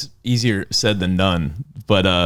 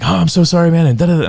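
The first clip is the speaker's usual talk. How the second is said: high, loud, over-the-top apologizing; gushing apology; 10 semitones higher, 7 dB louder, faster than usual. Retold or imitated. Friend retelling his guilty call, gushing apology. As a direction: pour out the apology, sorry, sorry. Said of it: "I was like".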